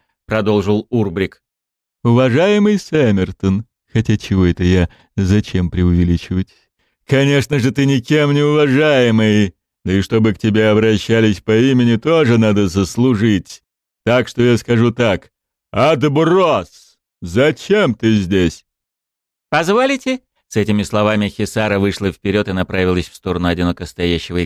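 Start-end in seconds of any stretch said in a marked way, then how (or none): 2.0-18.6 mocking sneer. King mocks the lone player. 19.5-20.2 put-on eager young woman. Hisara steps forward politely.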